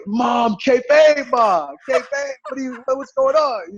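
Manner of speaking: high, loud mock outcry to mom; exasperated protest. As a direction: cry out in retold disbelief.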